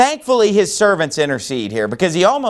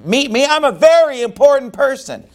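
higher, fast, indignant whine of a proud general; offended important man; 8 semitones higher than usual. Retold or imitated. Proud general complaining the prophet won't meet him, higher and indignant. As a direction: sound wounded that the prophet snubbed you.

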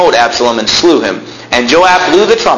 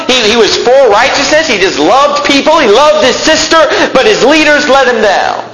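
fiery preaching; 8 semitones higher than usual. Acted. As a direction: preach it up to the rafters. Preaching at full force.

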